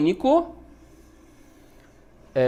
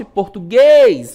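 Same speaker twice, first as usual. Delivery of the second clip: português with the final guês punched loud and stretched; slow português, hammering guês; stress on last syllable; 8 dB louder, much slower than usual. Shown as a demonstration. Teacher showing the strongest syllable, stressed guês in português. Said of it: "a sílaba mais forte".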